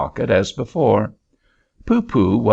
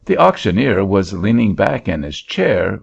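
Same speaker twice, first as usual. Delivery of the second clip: ordinary delivery, nothing marked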